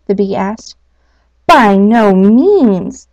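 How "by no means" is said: loud, slow calling out; called out. Acted. Owl calling to the bee, loud slow denial. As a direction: call it across the camp, drawn out. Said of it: "the feathered lady called out".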